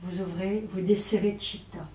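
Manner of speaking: usual speaking voice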